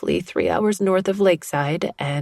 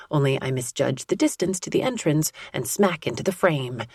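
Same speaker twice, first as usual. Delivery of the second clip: ordinary delivery, nothing marked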